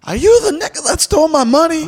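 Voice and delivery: high mocking old man voice; angry customer voice. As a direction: pitch it way up, indignant. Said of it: "And he was like".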